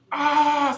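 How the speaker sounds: long loud high "ahh"; war cry before a heavy lift; imitated yell. Ex-player mimicking a teammate before lifting, drawn-out yell. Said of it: "starts yelling".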